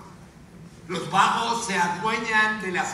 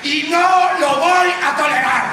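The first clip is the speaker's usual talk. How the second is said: high, loud, drawn-out rallying cry; furious shout; 7 semitones higher, 5 dB louder than usual. Acted. Anchor leading the whole country's yell, furious shout. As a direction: stretch every word in defiance. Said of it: "¡Grita y grita!".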